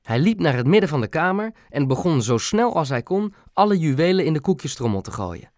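usual speaking voice

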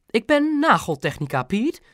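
high, sneering mother; scornful correction. A narrator's reading. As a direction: correct the husband disdainfully, pitched high. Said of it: "verbeterde Mam smalend". (smalend verbeterend)

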